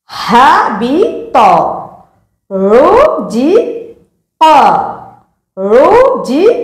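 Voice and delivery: হাবিত and রুজিক drawn out slowly; slow demo. Shown as a demonstration. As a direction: model each word slowly for the learner. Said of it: "এরকম বলতে হবে".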